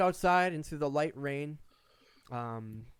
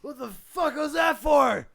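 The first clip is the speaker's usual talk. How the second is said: angry patron shout; 11 semitones higher, 8 dB louder than usual. Acted. Spilled drink, a patron voiced.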